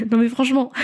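laughing nervously; a real nervous giggle. Real, not acted. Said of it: "c'est un rire nerveux".

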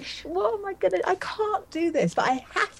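high, panicky inner voice; panicked. Retold or imitated. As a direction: squeak it out like you're about to lose it. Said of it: "and I was like".

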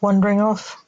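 plain reading voice

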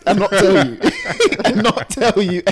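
giggling through the words; laughing. Real, not acted. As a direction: laugh while you say it. Teasing about serving old rice.

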